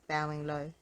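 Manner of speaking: usual speaking voice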